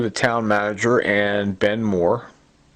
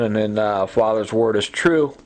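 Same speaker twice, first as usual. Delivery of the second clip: ordinary talk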